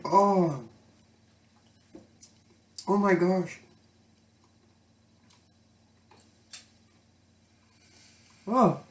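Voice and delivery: puckering at the sour taste; reacting to a very sour bite; eating sour candy. Real, not acted. Just ate sour candy.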